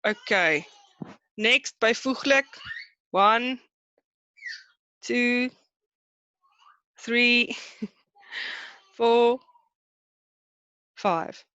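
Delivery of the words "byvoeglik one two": ordinary delivery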